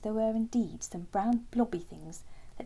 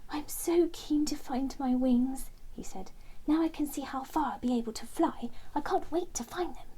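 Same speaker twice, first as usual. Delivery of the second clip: put-on higher-pitched, keen young hero; keen, impatient excitement, pitched up; eager Terence; 6 semitones higher, 3 dB louder than usual. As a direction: bubble with eagerness to get going. Terence eager to find wings.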